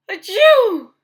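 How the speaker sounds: loud, high achoo; acted sneeze. Gerald interrupted by a loud sneeze. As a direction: cut off the word with a big sneeze.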